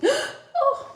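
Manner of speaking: very high, laughing "Oh" of delight; touched gasp. Retold or imitated. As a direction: melt at the little dog's hug. Moved by dog's hug.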